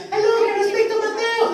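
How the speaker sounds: high, sweet caller voice asking for Mateo; girl on the phone. Retold or imitated. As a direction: put on a girl's phone voice. Phone call from girl acted.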